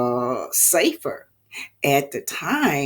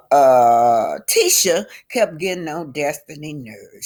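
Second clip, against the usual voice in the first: usual speaking voice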